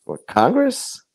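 retold surprise. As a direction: sound caught off guard, pitch leaping up. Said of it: "And I said".

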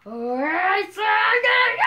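loud high threatening yell; angry shout. Pretend. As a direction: bark the threat at him. Threat cut off.

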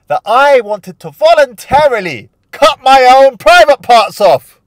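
high and loud, disbelieving; incredulous exaggeration. Real, not acted. Host marvelling at his teenage infatuation, high and loud. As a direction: blow it up for comic effect.

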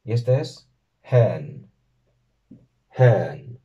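"hen" said slowly and clearly; teacher modelling the Sindarin for child; Sindarin word. Shown as a demonstration. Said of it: "Tanto para los dos".

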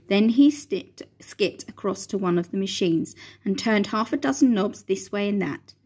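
usual speaking voice

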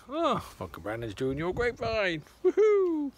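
high, loud gleeful cheer ending in a whoop; mock-excited message for Leanne, ending in a high woohoo. Voicing what to tell Leanne.

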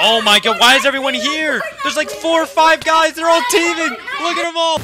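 high, loud alarmed shouting; frantic gamer freak-out; shocked outcry. Real, not acted. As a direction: yell in disbelief at being outnumbered. Finds several players teaming up.

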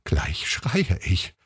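very slow, drawn-out inner voice; slow, barely contained urge to scream; mounting tense thought. A narrator's reading. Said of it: "dachte sie".

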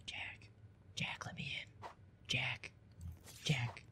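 spooky whispered pleading; breathy creepy whisper at the door. Pretend.

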